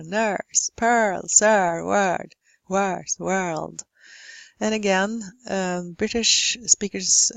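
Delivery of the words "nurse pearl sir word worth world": "nurse, pearl, sir, word, worth, world" with spread lips; smiled, unrounded vowel. Shown as a demonstration. Teacher modelling English vowel, "nurse, pearl, sir, word, worth, world" with smiling lips. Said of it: "is to smile as you say".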